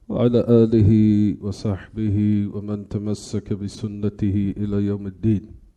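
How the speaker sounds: ordinary talk, nothing marked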